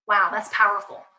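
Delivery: ordinary talk, nothing marked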